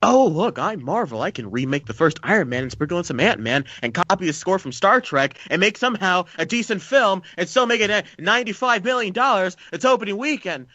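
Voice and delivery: high, mocking boast as a smug studio; boastful Marvel parody. Co-host poses as Marvel bragging about box office, mocking boast.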